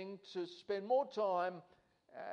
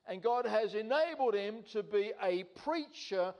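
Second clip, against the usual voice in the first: higher and louder, preaching at full force; fervent preaching; 4 semitones higher, 3 dB louder than usual. Acted. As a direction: drive each claim home from the pulpit.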